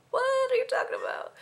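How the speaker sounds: very high, soft squeak; mock bafflement in a tiny high pitch; baffled reaction. Vlogger recalling reaction to director's odd idea, baffled.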